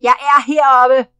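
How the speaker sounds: put-on loud, sulky child's call from up a tree; a grumpy small boy shouting down; cross little boy. Mowgli calls down crossly from the tree, loud. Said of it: "lød en vred lille stemme".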